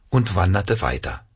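usual speaking voice

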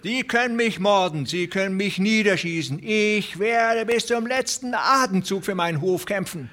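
put-on an old farmer vowing to die fighting; verzweifelter alter Bauer. A narrator's reading. (viel höher, trotzig)

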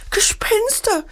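high-pitched cry of alarm, understated in volume; storyteller's high mock scream; frightened cry. A narrator's reading. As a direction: cry out like a scared child, without full volume. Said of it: "schrie Marie auf".

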